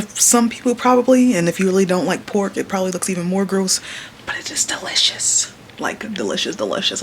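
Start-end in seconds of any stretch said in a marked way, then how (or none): 4.3-7.0 breathy whisper of pleasure; whispered delicious. Real, not acted. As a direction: whisper it like a secret treat. Savoring food on camera.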